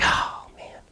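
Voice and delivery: under-the-breath whisper of frustration; whispered grumble. Retold or imitated. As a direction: mutter it to yourself, fed up. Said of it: "just stewing angry".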